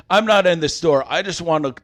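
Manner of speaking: angry parting shot. Retold or imitated. Ejected customer to shop staff from the door, angry. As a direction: raise the voice as if calling from the doorway.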